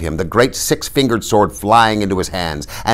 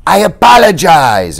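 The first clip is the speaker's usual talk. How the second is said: loud, high insistent apology; pleading, pitched-up apology; apologetic Inigo; 10 semitones higher, 9 dB louder than usual. A narrator's reading. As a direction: call the apology after an angry friend. Inigo calls apology after angry friend.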